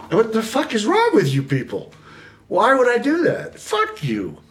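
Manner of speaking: high, far-off indignant outburst; indignant refusal. Retold or imitated. Refusing the cops' offer to snitch, high and indignant. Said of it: "and I".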